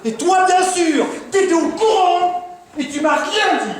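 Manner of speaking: high-pitched, indignant reproach; worked-up accusation. Acted. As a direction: turn on the accomplice, outraged. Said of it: "te mettre dans des états pareils".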